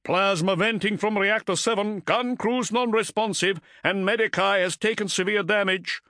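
high-pitched bridge officer reporting damage; urgent crewman calling out damage; officer's urgent report. A narrator's reading. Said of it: "snapping off further reports".

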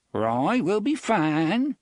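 quavery voice. A narrator's reading. Jonas answering Roy, a hint of a quaver. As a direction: let the old gunman's voice waver a little. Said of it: "Jonas said in his quavery voice".

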